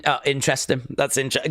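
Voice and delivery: usual speaking voice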